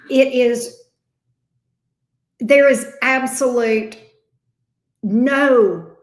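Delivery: loud, punched words of certainty; emphatic insistence. Real, not acted. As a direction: hammer each word home. Insisting the cards are clear.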